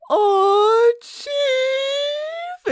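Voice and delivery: very high delighted squeal; excited squeal. Retold or imitated. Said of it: "Clawhauser then says".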